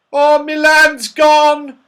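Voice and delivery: high, loud, drawn-out shriek; shrieking Saucepan Man. A narrator's reading. Saucepan Man finds his land missing, shrieking. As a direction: shriek in comic horror, stretching it. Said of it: "shrieked the saucepan man".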